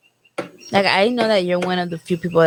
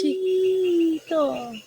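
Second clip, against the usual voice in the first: teasing drawl; 8 semitones higher, 6 dB quieter, much slower than usual. Pretend. Drawn-out teasing word.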